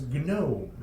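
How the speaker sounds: "Gnome" with a hard G sounded before N; G pronounced in gnome. Retold or imitated. Retelling friend's Russian-style slip, hard G sounded. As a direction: say "Gnome" as g-nome. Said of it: "and she says".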